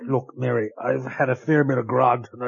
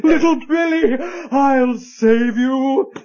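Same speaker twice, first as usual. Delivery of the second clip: jubilant cry; 10 semitones higher than usual. Acted. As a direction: sing out like a saved man. Merchant rushes to Billy.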